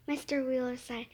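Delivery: ordinary delivery, nothing marked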